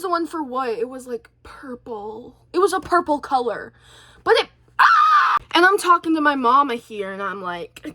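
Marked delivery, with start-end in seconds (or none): 4.8-5.4 very high, loud squeal of frustration; exasperated scream. Real, not acted. Fed up arguing about AI pictures, exasperated scream. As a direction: break off into a shrill shriek.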